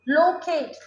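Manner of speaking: "लोकेट" with a long oh; "लोकेट", first vowel a long o; long o sound. Shown as a demonstration. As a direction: round and hold the o in "लोकेट". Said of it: "difference है short sound long sound".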